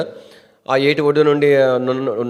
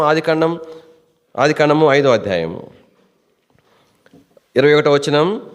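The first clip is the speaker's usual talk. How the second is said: ordinary delivery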